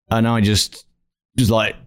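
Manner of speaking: normal talking voice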